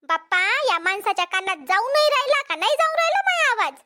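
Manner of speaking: ordinary delivery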